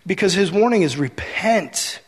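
ordinary talk, nothing marked

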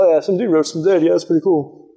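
quick, casual, underwhelmed shrug of a voice; mock indifference. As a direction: toss it off as if barely impressed. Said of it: "They can't just sit there and go".

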